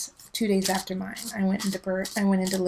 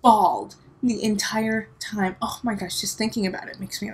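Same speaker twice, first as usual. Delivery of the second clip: ordinary talk, nothing marked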